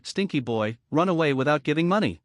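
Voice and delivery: plain reading voice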